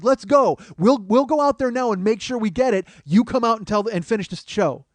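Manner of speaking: high, loud rallying call; rally everyone to move out; crowd shout. Retold or imitated. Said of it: "people are telling me from the crowd".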